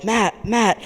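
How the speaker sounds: high, loud whoop of a name; cheering yell. Retold or imitated. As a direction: cheer your friend's name like a rowdy group. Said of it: "they cheered and they yelled".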